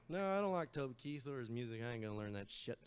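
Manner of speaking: normal talking voice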